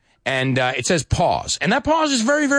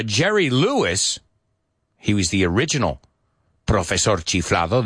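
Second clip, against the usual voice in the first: ordinary talk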